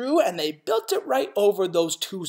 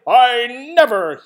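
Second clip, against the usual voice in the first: loud, higher, chest puffed with pride; proud loud declaration; 8 semitones higher, 12 dB louder than usual. Acted. North-going Zax, puffed with pride, declares loudly. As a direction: boom it out proudly. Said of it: "he said".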